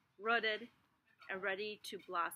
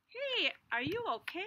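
higher, louder cartoon seed and worm; startled little voices; 7 semitones higher, 5 dB louder, slower than usual. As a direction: ham up the bump.

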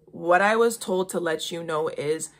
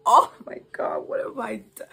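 panicked cry; 4 semitones higher, 4 dB louder than usual. Retold or imitated. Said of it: "he was, like".

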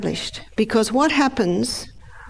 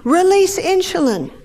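much higher urgent command; alarmed brain voice; 10 semitones higher, slower than usual. Acted. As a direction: brain barking orders in a panic. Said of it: "the brain says".

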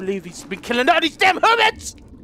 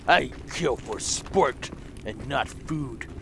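low, slow mock villain; low, slow hammed-up villain; 10 semitones lower, 7 dB quieter, slower than usual. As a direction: play the cold killer, slowly.

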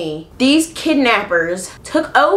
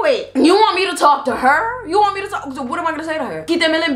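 higher, disbelieving questions; can't believe they asked; incredulous; 7 semitones higher than usual. Retold or imitated. Asked to talk to her, incredulous. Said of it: "I was like".

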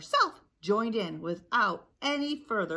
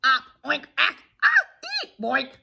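loud string of cartoon bonks and squeals; collision squawks; 7 semitones higher, 12 dB louder than usual. Flock crashes about making noises, loud squawks. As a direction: jumble of sudden yelps and boinks.

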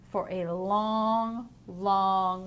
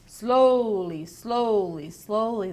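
drawn-out story refrain; 6 dB louder, slower than usual. A narrator's reading. Teacher reading the sloth book to children, stepping down the refrain.

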